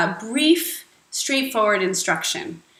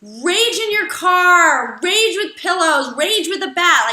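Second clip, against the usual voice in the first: high, loud raging call; louder, higher fired-up exhortation; rage; 7 semitones higher, 5 dB louder than usual. Real, not acted. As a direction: shout out the rage. Preaching rage release.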